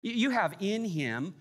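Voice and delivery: ordinary talk, nothing marked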